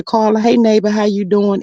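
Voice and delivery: ordinary delivery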